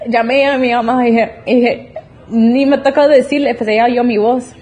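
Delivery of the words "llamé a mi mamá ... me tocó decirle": laughing while telling. Real, not acted. Laughing while retelling the good news.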